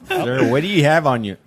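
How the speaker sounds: cop voice. Pretend. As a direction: bark it like a cop. Acting out a police stop.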